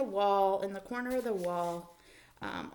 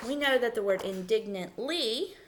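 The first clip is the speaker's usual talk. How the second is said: ordinary talk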